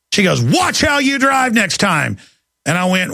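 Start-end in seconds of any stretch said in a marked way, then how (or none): 0.4-2.2 slow, high, lightly voiced nagging lady; a hint of a snippy stranger, pitched up; mock scolding woman. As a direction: imitate her lecturing tone. Woman scolds him at truck.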